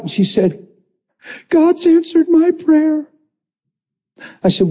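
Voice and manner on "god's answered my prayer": high, slow tearful voice; imitated bawling. Head of HR crying over answered prayer, tearful. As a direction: bawl it out in a high voice, imitating her. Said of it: "she starts bawling".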